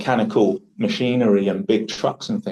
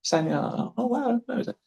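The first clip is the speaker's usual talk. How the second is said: high-pitched, softer voice imitating an impressed new acquaintance; put-on high voice of an amazed listener; excited Chinese acquaintance; 8 semitones higher, 3 dB quieter than usual. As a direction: mimic someone marvelling in quick little reactions. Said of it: "whenever I meet a Chinese person".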